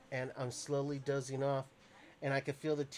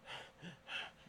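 relieved sigh; 2 semitones higher, 12 dB quieter than usual. Retold or imitated. Relief after waking.

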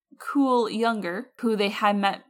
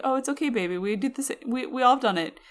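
soothing; 5 semitones higher, faster than usual. Retold or imitated. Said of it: "I was like".

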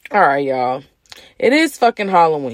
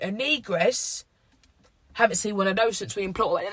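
snooty royal voice; 8 dB quieter than usual. Retold or imitated. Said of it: "They probably was like".

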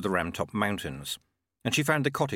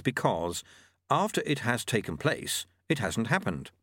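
usual speaking voice

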